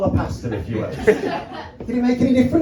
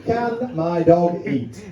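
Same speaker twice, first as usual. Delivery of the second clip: slow repeat; 2 semitones lower, much slower than usual. Acted. Repeats query slowly.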